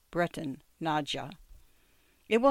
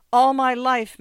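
high, loud outburst of despair; anguished cry; 7 semitones higher, 10 dB louder than usual. A narrator's reading. Old man crying out to the ticket collector, anguished. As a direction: cry it out, pleading with Ginsberg. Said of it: "Mendel cried".

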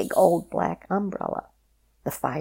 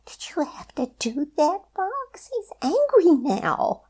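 very high anxious bunny voice; worried Rabbit; 12 semitones higher, 4 dB louder than usual. A narrator's reading. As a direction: fret over the angry wolf. Rabbit scolds Fox, worried.

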